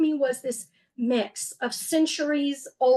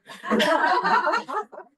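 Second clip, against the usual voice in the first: short laugh; 6 semitones higher than usual. Real, not acted. Laughing at own thought.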